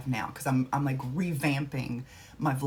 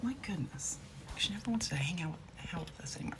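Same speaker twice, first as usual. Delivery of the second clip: whispering; 3 semitones higher, 7 dB quieter, faster than usual. Real, not acted. Muttering about the dog.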